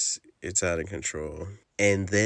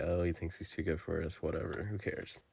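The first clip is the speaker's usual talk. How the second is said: quick, quieter snide muttering; mock snooty locals; 2 semitones lower, 9 dB quieter, faster than usual. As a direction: brush him off with a sneer under your breath. Locals snub the refused drink.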